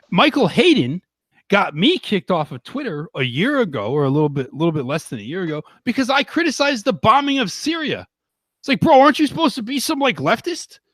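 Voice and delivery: really fired up and venting; real heated outrage, voice climbing high; heated rant. Real, not acted. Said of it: "I started to get a little heated".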